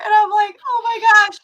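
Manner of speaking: high, loud laughing exclamation. Real, not acted. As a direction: squeal it through laughter. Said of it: "I'm laughing so hard".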